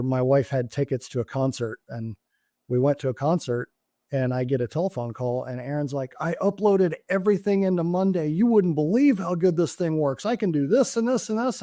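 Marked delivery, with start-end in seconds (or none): 6.2-11.6 higher-pitched, eager gushing as someone else; imitating excited caller. Aaron phoning his boss about the new tool, imitated excitement. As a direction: act out a thrilled colleague's rush of news.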